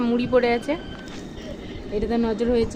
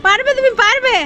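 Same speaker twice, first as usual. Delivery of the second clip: high, louder encouraging sing-song; urging her pet to grab the puffed rice, high and coaxing; coaxing pet talk; 12 semitones higher, 4 dB louder than usual. Acted. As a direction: cheer the little one on like a baby. Cheering pet on.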